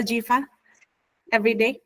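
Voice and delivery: ordinary talk, nothing marked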